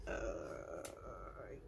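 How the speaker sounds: whispered start, then high uneasy voice; lightly voiced creeped-out clerk; nervous clerk. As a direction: back away from a creepy customer. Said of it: "the clerk is just like".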